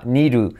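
"need to" said as niru, tapped R; soft Spanish R. Shown as a demonstration. Teacher shows D and T merging, soft tap. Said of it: "se convierten en una R suave española".

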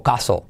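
"caso" with a pure A, no glide; second accepted variant. Shown as a demonstration. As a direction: say "castle" as "caso", with silent T. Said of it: "Las dos valen". ("caso" con a limpia, sin T)

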